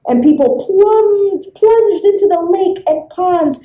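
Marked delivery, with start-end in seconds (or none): none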